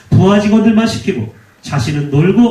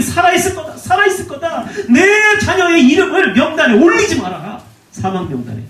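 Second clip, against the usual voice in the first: desperate parents; 7 semitones higher than usual. Retold or imitated. 배 사고 부모들의 외침 재현.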